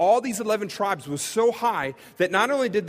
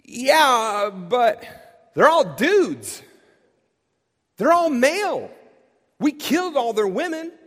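much higher, louder, worked-up protest; emphatic objection; 7 semitones higher, 5 dB louder than usual. Retold or imitated. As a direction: shoot the plan down with alarm. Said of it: "He's like".

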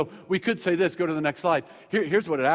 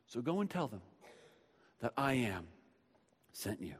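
God's fading command; 5 semitones lower, 12 dB quieter than usual. Acted. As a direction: lower and softer with every phrase, down to a near hush.

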